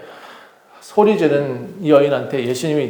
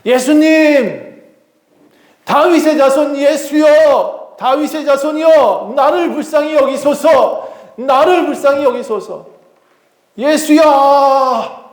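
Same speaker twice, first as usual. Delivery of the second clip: high, drawn-out pleading shout; desperate cry; 10 semitones higher, 3 dB louder, slower than usual. Acted. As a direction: cry out for mercy at full stretch. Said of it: "예수님을 만나자마자 소리쳤습니다". (절박하게 길게 외치는 소리)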